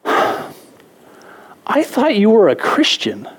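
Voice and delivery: slow, high, drawn-out mock of the wife; wife's rebuke. Wife rebukes her complaining husband in the car, slow and high. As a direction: stretch it out like a stinging reproach. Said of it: "and she goes".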